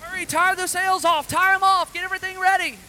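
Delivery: loud urgent shouts over the storm; shouting fisherman. Acted. Fisherman yelling to his crew in the storm, loud and urgent. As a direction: bellow orders to the crew.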